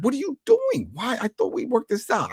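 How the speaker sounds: high-pitched, protesting bewilderment; put-on indignant questioning; mock disbelief. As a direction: imitate a baffled man arguing back. Acted quarrel, his shocked reply.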